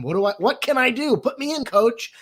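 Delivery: high, eager mock volunteer. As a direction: beg to get in the game. Eager ally voiced.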